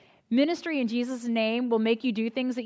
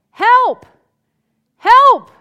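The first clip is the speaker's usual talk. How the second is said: put-on high, loud cry of help from a small child; calling for help; 10 semitones higher, 16 dB louder than usual. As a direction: shout for help from behind a closed door. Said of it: "I hear this voice ... it's saying".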